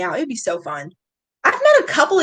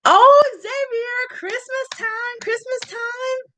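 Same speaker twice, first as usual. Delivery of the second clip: tender coo; 13 semitones higher, slower than usual. Real, not acted. Streamer cooing over a viewer's Christmas reunion. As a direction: coo it sweetly.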